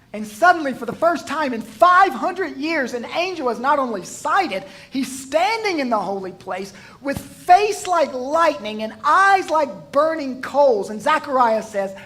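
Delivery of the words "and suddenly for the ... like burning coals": higher, louder build to the angel's arrival; full-force preaching. Acted. Preacher describing the angel in the holy place, loud and high. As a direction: preach it up, awed and rising.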